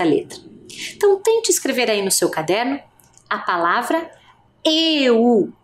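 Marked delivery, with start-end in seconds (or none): 4.6-5.5 "eu" said slowly and clearly; dictating "eu" for children to write; dictation. Shown as a demonstration. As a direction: dictate "eu" to the class. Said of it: "Eu vou fazer a pronúncia".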